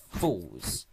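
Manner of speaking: ordinary delivery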